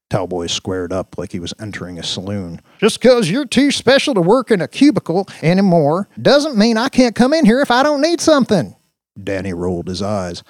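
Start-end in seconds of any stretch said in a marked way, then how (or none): 2.8-8.8 very loud, high swaggering deputy; belligerent Brick. Acted. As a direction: holler back at the tech boy.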